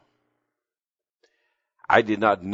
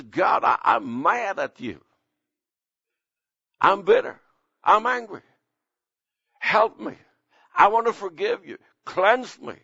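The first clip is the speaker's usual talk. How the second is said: higher, pleading cry to God, building; raw confession prayer; 12 semitones higher than usual. Acted. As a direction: cry out your anger to God, then beg help. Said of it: "We need to come out and say".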